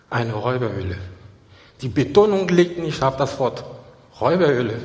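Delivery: normal talking voice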